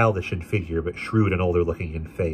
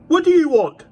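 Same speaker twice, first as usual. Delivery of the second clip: put-on rude boy; 9 dB louder than usual. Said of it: "a very hideous boy came out".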